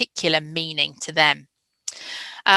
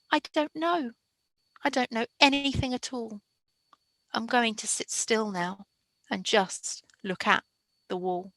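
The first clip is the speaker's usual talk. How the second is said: much softer, trailing into near silence; fading lost child; 4 semitones higher, 8 dB quieter than usual. Shown as a demonstration. Teacher ends the lost child's poem, fading very soft. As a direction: give up quietly, fading to nothing. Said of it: "listen to the difference".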